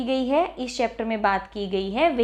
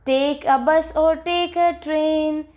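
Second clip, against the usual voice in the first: slow, higher sing-song recital; poem recitation; 4 semitones higher, much slower than usual. Shown as a demonstration. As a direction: recite it like a children's rhyme, drawn out. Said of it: "so let us recite this".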